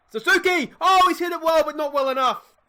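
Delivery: sudden high, loud shout, then fading disappointment; getting excited. Real, not acted. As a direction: yell his name as the ball flies. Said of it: "I'm getting excited now".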